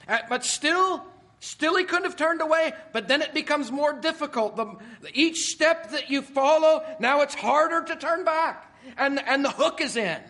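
loud, high, driving sermon voice; preaching at full force. Acted. Preacher pressing the congregation hard, loud and high.

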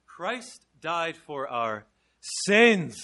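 slow "Christ died for our", then "sins" loud; stress on SINS. Shown as a demonstration. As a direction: hold back, then hit "sins". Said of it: "just emphasizing different points".

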